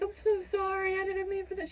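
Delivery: high, tearful, pleading sorry; emotional apology. Retold or imitated. Apologizing to the nurse after soaking her hands, tearful plea. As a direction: plead for forgiveness, near tears. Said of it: "I was so emotional".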